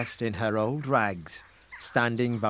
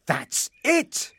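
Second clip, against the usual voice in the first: high, sudden cry of inspiration; excited cry; 10 semitones higher than usual. A narrator's reading. Frederick struck by an idea, excited cry. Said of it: "cried Frederick".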